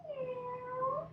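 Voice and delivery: high cat meow. Shown as a demonstration. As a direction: meow like a kitten. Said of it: "what sound does a cat make".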